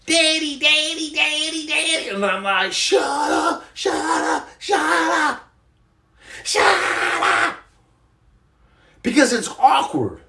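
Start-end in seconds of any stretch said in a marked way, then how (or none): 0.1-2.1 high-pitched imitation of screaming kids. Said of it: "they're screaming up and down". 2.7-7.6 high strained screech, held back in volume; hammed-up high-pitched exasperated cry; mock screaming retort. Acted retort to screaming kids.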